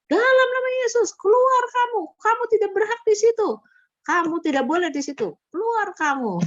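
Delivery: very high, loud casting-out cry, easing toward the end; imitated exorcism shout. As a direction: shout the spirit out as the believer did. Retold exorcism at full cry.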